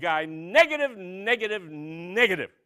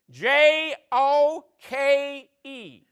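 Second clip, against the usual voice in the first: slow, higher, letter by letter; contestant spelling; 6 semitones higher, 4 dB louder, much slower than usual. Pretend. Contestant spelling the punchline, slow and higher. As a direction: drag each letter.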